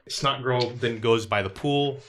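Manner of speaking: ordinary delivery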